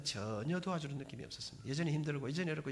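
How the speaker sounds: ordinary talk, nothing marked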